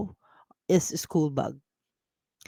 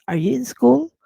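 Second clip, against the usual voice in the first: are you in school, pitch up on school; teacher asking are you in school with a high rise; question rise; 7 semitones higher, 4 dB louder than usual. Shown as a demonstration. Teacher repeats the question, school rising high. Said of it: "like asking if you are in school".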